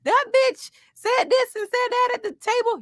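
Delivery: put-on angry girl. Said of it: "She's like".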